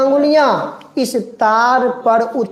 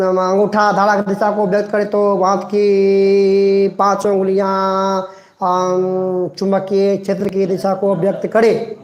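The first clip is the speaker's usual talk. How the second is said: fast mumbled rattling off by heart, with filler "आम, आम"; mock rote cramming; 2 semitones lower than usual. Shown as a demonstration. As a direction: rush through it like a student mugging up. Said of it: "आप लोग देखिए घर में ऐसे पढ़ते होंगे". (रट्टा मारते हुए तेज़ी से बड़बड़ाना)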